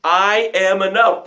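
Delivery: ordinary talk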